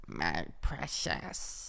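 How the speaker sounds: low, slow, creepy croak; Gollum voice. Acted. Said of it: "what does Gollum sound like?".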